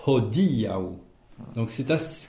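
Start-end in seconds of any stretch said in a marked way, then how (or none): 0.0-0.8 "Hodiaŭ" with aspirated H, stressed on di. Shown as a demonstration. Teacher modelling the next-to-last syllable accent, stress on DI. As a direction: punch the di in "Hodiaŭ". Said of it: "c'est le di, pardon".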